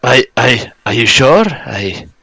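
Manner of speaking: put-on lightly done northern Scottish accent, dazed; a revived dwarf in a northern Scottish accent, confused. GM voices Gundren to the party in a northern Scottish accent. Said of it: "his northern Scottish accent".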